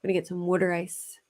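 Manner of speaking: put-on water as "wooder" in a Philly accent; casual Philadelphia "wooder ice". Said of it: "That's how all Philadelphians say it".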